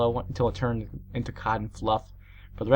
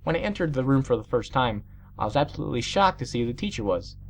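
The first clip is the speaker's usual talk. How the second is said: ordinary delivery, nothing marked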